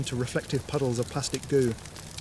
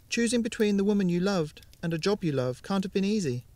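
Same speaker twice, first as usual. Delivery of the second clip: put-on higher, gentle girl's voice; young girl Ramona; 7 semitones higher than usual. Ramona speaking to Jared about his choice, higher girl's voice.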